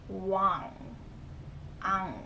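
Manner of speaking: wang, then just its ang part; wang with ng ending. Shown as a demonstration. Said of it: "pronounce it with the I N G".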